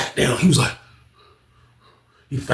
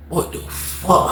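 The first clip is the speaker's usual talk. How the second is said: slow, whispered disbelief; shocked whisper; 4 semitones higher, 4 dB quieter, much slower than usual. Retold or imitated. Onlooker hearing the knife story, slow whisper. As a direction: breathe it out in shock.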